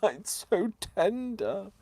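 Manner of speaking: slow, high swooning gush; mock swoon. Savouring a tender bite, high swooning voice. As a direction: gush over it, slowly and high.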